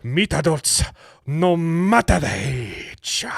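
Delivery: put-on slow, drawn-out Japanese accent; mock Japanese accent. Reading a dating ad to co-hosts, mock Japanese accent. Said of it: "it's gotta be Japanese".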